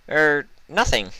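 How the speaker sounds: nervous. Acted. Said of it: "with a nervous laugh".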